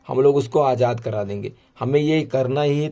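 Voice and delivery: normal talking voice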